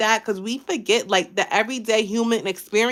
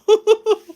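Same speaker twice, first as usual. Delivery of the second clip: Stitch giggle; 13 semitones higher, 6 dB louder than usual. Shown as a demonstration. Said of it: "It sounds like Stitch".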